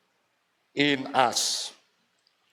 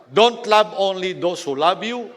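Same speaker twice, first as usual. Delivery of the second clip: building higher and louder to a cry; rising exhortation; 5 semitones higher, 4 dB louder than usual. Acted. Pastor urging the congregation to love enemies, building to a ringing call. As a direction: climb to a ringing high call.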